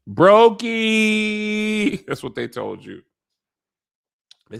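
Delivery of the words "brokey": mocking taunt. Mocking Daemon as broke.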